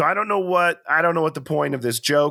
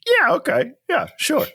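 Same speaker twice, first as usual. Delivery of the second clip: sarcastic agreement; 12 semitones higher than usual. Real, not acted. Sarcastic reply to bad haircut.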